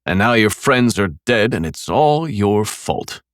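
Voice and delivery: mocking. A narrator's reading. Killer blaming Nick for his friends, mocking. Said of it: "I continued mocking him".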